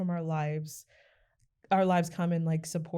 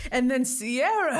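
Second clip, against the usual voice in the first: mock sappy tug; 7 semitones higher, 6 dB louder, slower than usual. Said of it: "you're like".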